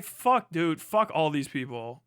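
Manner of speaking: ordinary talk, nothing marked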